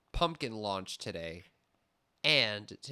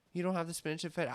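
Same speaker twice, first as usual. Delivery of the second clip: pushy customer; 7 semitones higher, much faster than usual. Retold or imitated. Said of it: "customer again".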